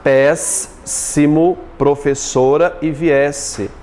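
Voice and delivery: "Péssimo, professora e viesse" slowly divided; split into syllables. Shown as a demonstration. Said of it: "A separação de sílabas".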